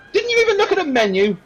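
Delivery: very high, quick complaint; exasperated barmaid. Acted. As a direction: scold an unprepared customer. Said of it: "She goes".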